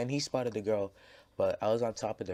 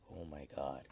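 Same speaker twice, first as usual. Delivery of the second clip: soft, lower gasp of disbelief; stunned under breath; 6 semitones lower, 10 dB quieter than usual. Retold or imitated. A kid murmuring in shock on the stairs. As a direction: murmur it, appalled. Watching friend grab girl.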